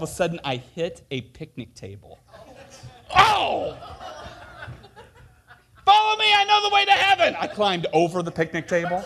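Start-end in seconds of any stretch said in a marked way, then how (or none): 3.2-3.8 sudden yelp. Retold or imitated. Hits picnic table. 5.8-7.4 needless yelling. Retold or imitated. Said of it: "just yelling at everyone needlessly".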